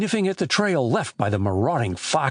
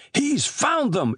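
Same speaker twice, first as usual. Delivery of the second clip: high, drawn-out cry of discovery; excited shout; 13 semitones higher, slower than usual. Acted. Chet calling to chums as the dog finds the den, high excited shout. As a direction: call out high and slow, without much volume. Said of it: "shouted Chet".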